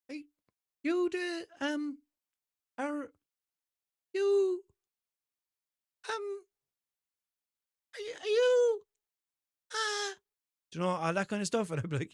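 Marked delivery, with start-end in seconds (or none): 0.1-10.1 high-pitched, halting impression of a fan; mock tongue-tied stranger, long gaps between words; hesitant fan. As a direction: stammer out a question in a squeaky voice. Hesitant fan trying to recognise him.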